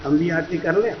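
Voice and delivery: softly imitated timid question, rising at the end; quiet request. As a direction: ask it low and soft, as if approaching discreetly. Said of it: "धीरे से आके पूछे".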